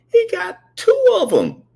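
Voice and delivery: put-on high, loud tearful boy; crying child. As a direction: wail it like a kid.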